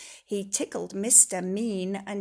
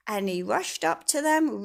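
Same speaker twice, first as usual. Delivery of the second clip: plain reading voice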